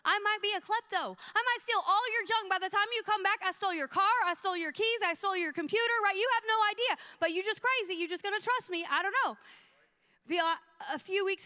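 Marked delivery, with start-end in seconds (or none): 0.0-9.4 higher-pitched comic rant; mock warning. Woman to trusting coffee-shop lady, incredulous mock warning. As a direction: rattle off the possible thefts in mock disbelief.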